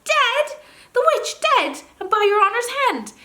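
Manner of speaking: very high, loud squeaky disbelief; astonished gnome. Acted. Gnome learning the witch is dead, high squeaky disbelief. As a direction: gasp it out, hardly daring to believe.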